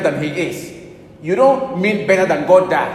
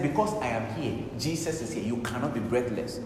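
quiet firm command; 4 semitones lower, 10 dB quieter than usual. Retold or imitated. Retold command at dying girl.

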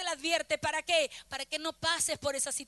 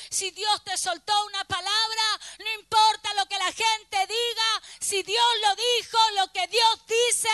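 loud, fervent sermon climax; preaching at full force; 2 semitones higher, 9 dB louder, slower than usual. Real, not acted. Preacher declaring God's word to the church, full-force preaching. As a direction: build to a booming, emphatic declaration.

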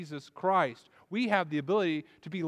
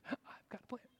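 high whispered glee, much softer; giddy whispered Jonah; 9 semitones higher, 16 dB quieter than usual. Retold or imitated. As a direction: hug the secret and whisper it to yourself. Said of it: "deliriously happy".

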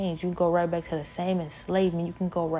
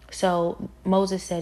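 ordinary talk

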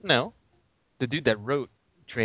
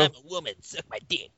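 G.I. Jane quote; 5 semitones higher than usual. Retold or imitated. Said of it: "Does she G I Jane it".

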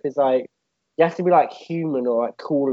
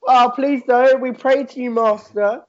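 mock pleading; 8 semitones higher, 3 dB louder, slower than usual. Villagers fake-begging the bug lord.

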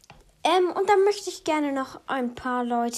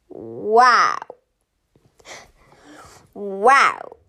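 deep wow voice; 5 semitones lower, 7 dB louder, much slower than usual. Pretend. Repeated deep wows as a bit.

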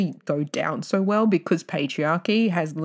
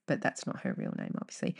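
hushed aside; 3 semitones lower, 9 dB quieter, faster than usual. Real, not acted.

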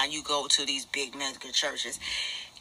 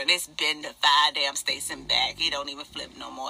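usual speaking voice

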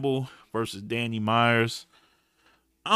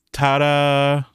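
mock ta-da; 9 dB louder than usual. Presenting the formula's punchline.

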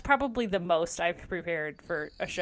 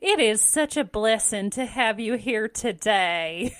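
exaggerated Southern accent; 4 dB louder than usual. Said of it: "exaggerated Southern accent".

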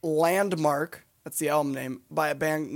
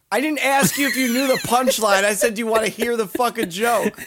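high, loud mock-angry rant; exasperated outburst; 8 semitones higher, 7 dB louder than usual. Joke teller scolding friend who spoiled the punchline, exasperated outburst. Comedian cut off by heckler's punchline.

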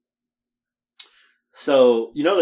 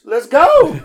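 higher excited let's go; 14 semitones higher, 9 dB louder than usual. Retold or imitated. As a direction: GO way up high, loud and falling. Excited ride offer repeated.

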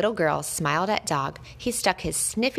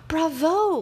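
high, loud cheer; a ballet dancer cheering the dog; cried out; 11 semitones higher, 4 dB louder than usual. A narrator's reading. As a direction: cry it out with delight. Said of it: "cried the great ... ballet dancer".

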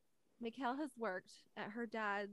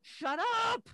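loud, high exasperated yell; frustrated shout; 13 semitones higher, 10 dB louder than usual. Retold or imitated. Mom trying to order over screaming kids, frustrated yell. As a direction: reenact snapping at noisy kids. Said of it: "getting so frustrated".

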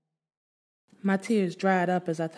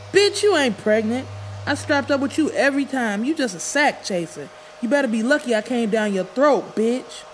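angry insult; 6 semitones higher, 4 dB louder than usual. Acted. Dwayne insulting her back.

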